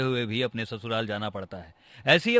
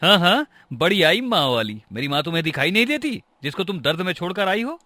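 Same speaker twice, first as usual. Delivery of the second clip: loud, higher, mocking retort; flaring up; 5 semitones higher, 5 dB louder than usual. Husband quarrels with his wife over his mother, flaring up. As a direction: fire back as an angry husband. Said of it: "आप इतना क्यों भड़क रहे हैं".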